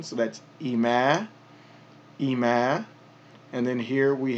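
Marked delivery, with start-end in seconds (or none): 0.6-1.3 "ima" said with the ma stretched long; elongated ima. Shown as a demonstration. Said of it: "so that's an elongation". 2.2-2.9 "ima" said with the ma stretched long; elongated ima. Shown as a demonstration. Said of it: "so that's an elongation".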